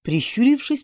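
ordinary delivery, nothing marked

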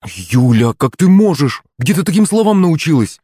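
high, scandalized mother's outcry; appalled reproach; shocked exclamation. A narrator's reading. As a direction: scold in disbelief, voice climbing. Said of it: "воскликнула Юлина мама".

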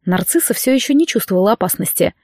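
plain reading voice

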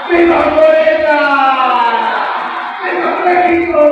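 shouted vivas to the crowd; rallying cheer. Real, not acted. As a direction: belt out each viva.

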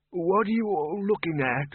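put-on high, puzzled old wizard; lightly voiced old man, puzzled; old Windle. A narrator's reading. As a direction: peer round at them, baffled. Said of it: "he said".

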